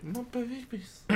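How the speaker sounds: very soft, high anguished breath; whisper. Acted. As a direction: barely voice it in despair.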